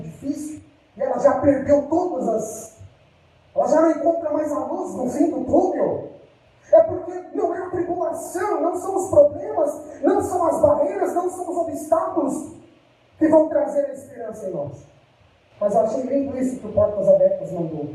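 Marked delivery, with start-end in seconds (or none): 3.9-14.9 preaching. Acted. Preaching at full force.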